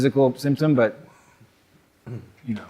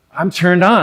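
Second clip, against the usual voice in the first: mock confession; 7 semitones higher, 6 dB louder than usual. Acting out an attraction statement.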